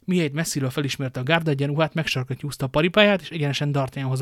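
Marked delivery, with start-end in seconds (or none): none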